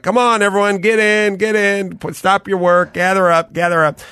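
high, calling everyone over; calling out to a crowd. Retold or imitated. Organizer calls crowd together.

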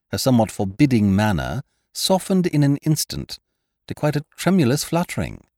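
usual speaking voice

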